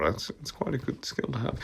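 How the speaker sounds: usual speaking voice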